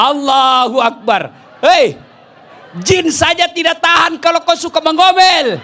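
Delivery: loud, high preaching punchline; preacher shouting the moral at the women. Acted. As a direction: hammer the lesson home to the crowd.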